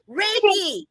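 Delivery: Reiki said high, in disbelief; incredulous Reiki; incredulous stress. Real, not acted. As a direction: scoff at the word Reiki. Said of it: "Now they have Reiki in the V A".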